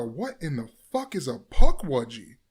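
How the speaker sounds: incredulous; baffled outburst. Retold or imitated. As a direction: drag it out in shock. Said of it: "I look at Lisa and I go".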